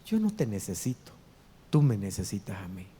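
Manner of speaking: a hushed, low retelling of God's words. Retold or imitated. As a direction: drop low and quiet for God's gentle correction. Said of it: "Y Dios me mostró".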